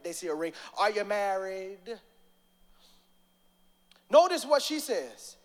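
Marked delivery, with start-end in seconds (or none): 0.8-1.8 lightly mocking prophet question; nosy prophet, low-key; mock biblical prophet. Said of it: "That's how the prophets do".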